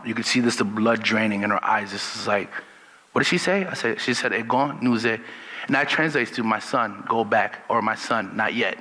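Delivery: usual speaking voice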